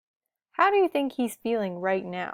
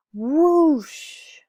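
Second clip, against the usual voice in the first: loud whooshing wind; 6 semitones higher, 8 dB louder than usual. A narrator's reading. Wind blows the web away.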